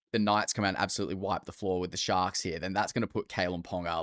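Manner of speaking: ordinary talk, nothing marked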